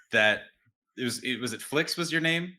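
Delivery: ordinary delivery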